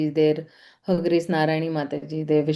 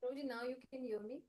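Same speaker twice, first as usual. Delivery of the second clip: faint, far-off voice asking; very faint, almost inaudible; barely audible; 5 semitones higher, 22 dB quieter, faster than usual. Real, not acted. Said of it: "Ekdam nahi".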